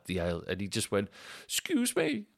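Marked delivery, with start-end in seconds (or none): 1.4-2.2 imitating plane passenger. Man on plane wants seat.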